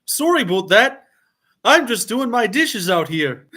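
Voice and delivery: high, loud, hammed-up Canadian accent. Shown as a demonstration. Streamer showing off their best accent for viewers, mock Canadian. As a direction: ham up a Canadian apology, pitched high. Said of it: "I'll do my best Canadian accent".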